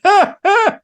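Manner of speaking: high mock "ha, ha"; imitated gloating laugh; taunting laugh. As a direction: crow it over a beaten man. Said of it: "standing over me going".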